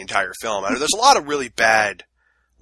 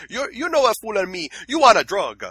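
put-on Italian accent; 8 semitones higher, 4 dB louder than usual. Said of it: "really thick accent".

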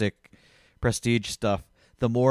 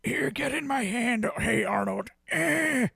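put-on high, strained gargling old voice; Patrick Stewart; 13 semitones higher, 4 dB quieter, slower than usual. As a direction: croak it out like a frail old star. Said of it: "Patrick Stewart is sounding bad".

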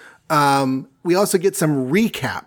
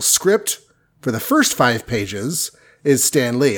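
ordinary delivery

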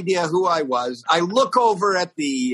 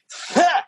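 put-on very high, short old man's huff; high-pitched mock scoff; imitated scornful huff; 13 semitones higher than usual. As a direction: mimic a dismissive old man's grunt.

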